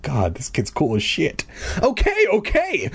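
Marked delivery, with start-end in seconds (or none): 1.8-2.9 very high placating squeal; pleading cry. A narrator's reading. Bully giving in to the boy, high pleading cry. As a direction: give in with a high-pitched cry. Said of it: "Marv cried".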